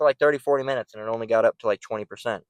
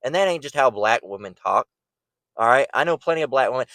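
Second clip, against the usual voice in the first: ordinary talk, nothing marked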